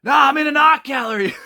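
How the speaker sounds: very high, loud shouted answer, laughing through it; prankster's phone hello. Retold or imitated. Prankster in art gallery yelling into giant phone, very high and loud. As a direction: yell it like a ridiculous phone prank. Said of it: "and he goes".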